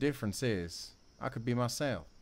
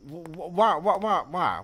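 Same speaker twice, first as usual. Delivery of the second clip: higher, louder sputtering whys; stammering outburst; 7 semitones higher, 8 dB louder than usual. Acted. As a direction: choke out the whys in frustration. Confronting her over the phone.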